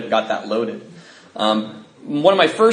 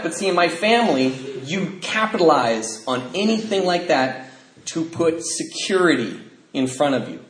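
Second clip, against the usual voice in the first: ordinary talk, nothing marked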